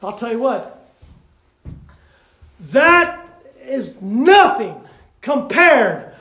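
loud, rousing pulpit preaching; preaching at full force. Acted. Preacher rallying the church behind Sister Barbara, loud and fervent. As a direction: whip the congregation up with every phrase.

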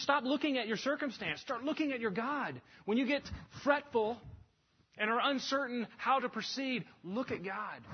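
high, insistent preaching; urgent exhortation. Acted. Peter urging fretful believers to look to God, urgent exhortation.

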